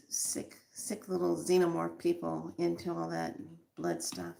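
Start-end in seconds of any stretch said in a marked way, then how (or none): 0.1-1.1 whispered. Real, not acted. Disgust at cruel rituals.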